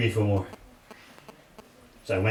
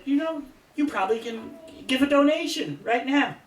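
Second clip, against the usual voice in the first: much higher woman's voice, coaxing; sweetly asking lady; 14 semitones higher than usual. Retold or imitated. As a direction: gently push for a donation as the lady.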